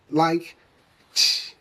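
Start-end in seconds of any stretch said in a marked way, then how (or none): 1.1-1.5 ch alone, as at the start of chair; soft CH sound. Shown as a demonstration. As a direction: let out a voiceless ch with no vowel. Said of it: "mistaken for the soft C H sound".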